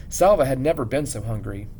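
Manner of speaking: ordinary delivery, nothing marked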